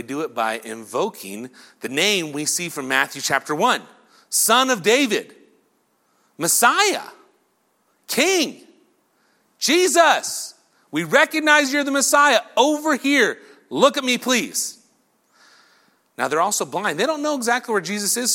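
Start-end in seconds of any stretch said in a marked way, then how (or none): 4.3-14.8 high, insistent hollered plea; loud calling. Acted. Blind beggars invoking Jesus over the crowd, loud calling. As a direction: call out title after title to be heard. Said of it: "They're so loud".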